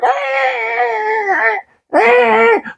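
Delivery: very high shrieking cry; mock scream. Characters seeing severed fingers, high shrieking scream. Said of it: "they're like".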